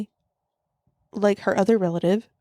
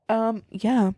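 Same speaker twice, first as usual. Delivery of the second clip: ordinary talk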